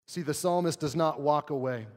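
ordinary talk, nothing marked

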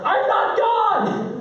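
high, slow, drawn-out yell; exasperated shout. Retold or imitated. Dad besieged by simultaneous questions, exasperated mock shout. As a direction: act out an overwhelmed parent's outburst. Overwhelmed dad's imagined outburst to kids.

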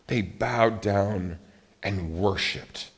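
hushed reverence. Shown as a demonstration. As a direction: let it sink down slow and hushed. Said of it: "It says".

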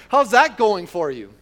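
loud, mocking jab; sarcastic retort. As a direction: throw it back sarcastically, loudly. Spouse snapping back at you.

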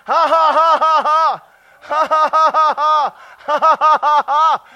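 loud, deliberate runs of ha-ha; practice laughing. Shown as a demonstration. Preacher practicing laughing with the church, loud forced laughter. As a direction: laugh on purpose in loud bursts. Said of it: "practice some laughing".

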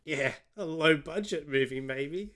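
odd attempt at English, drifting Australian; weird Australian accent. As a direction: put on a wonky English accent, sounds Australian. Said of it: "I did a weird Australian accent".

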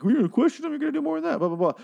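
high squeaky mock of Shelby. Mocking Shelby's retort to cop.

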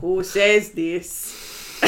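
put-on slow, drawn-out Italian accent. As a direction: stretch it out in hammy Italian. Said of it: "the Italian accent".

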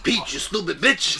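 mock-angry Italian accent; lightly hammed-up irate Italian plumber. Mario insulting the princess, mock-angry Italian. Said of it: "Just an angry Italian".

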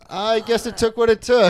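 high, loud laughing delivery; giggling meeting mantra. Retold or imitated. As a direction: laugh through the cliché. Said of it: "we're like".

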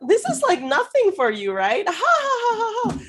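high, hammed-up fawning with fake laughter; mock high-pitched giggly flattery; over-the-top flattery. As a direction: mimic a gushing girl laughing at her own flattery. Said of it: "so over the top in reacting".

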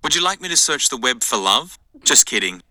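ordinary talk

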